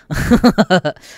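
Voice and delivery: loud high laugh. Real, not acted. Laughing at telling Pokemon haters off. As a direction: laugh out at his own jab.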